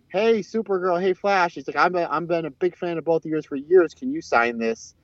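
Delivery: lightly voiced eager fan, high-pitched greeting. Fan greets Supergirl and Flash, lightly mock-eager. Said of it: "you hear this voice going".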